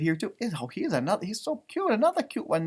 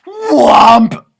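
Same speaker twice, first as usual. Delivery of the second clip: booming shouted whomp; big cartoon whomp; loud whomp sound; 4 semitones higher, 15 dB louder than usual. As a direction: land the whomp hard like a thud. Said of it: "I'm gonna do that again".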